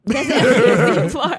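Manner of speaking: repeated through laughter; laughing. Real, not acted.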